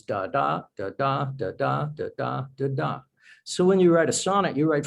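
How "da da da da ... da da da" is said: "da, da" chanted, weak then strong; iambic beat. Shown as a demonstration. Poet demonstrating iambic pentameter to the host, chanted da-DUM. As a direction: tap out the iambic rhythm. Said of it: "Each foot in those five is".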